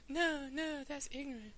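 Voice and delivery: soft, high falsetto; gentle, high-pitched pop-star caricature. Acted. Host objecting as the singer, soft high falsetto impression. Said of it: "I'm gonna do [name]".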